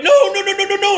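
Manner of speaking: high, loud string of panicked pleas; acted alarm, pitch shooting way up. Man at gunpoint protests to cop, high loud panic.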